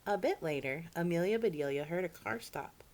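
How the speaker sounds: ordinary delivery, nothing marked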